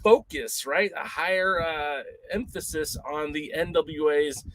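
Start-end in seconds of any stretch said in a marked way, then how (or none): none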